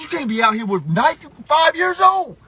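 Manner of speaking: high-pitched disbelief; dad appalled at his kid; exasperated scolding. Retold or imitated. Scolding child over knife.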